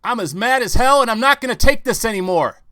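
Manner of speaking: full-throated furious yell out the window. Retold or imitated. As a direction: scream it with everything you have. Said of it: "stick your head out and yell".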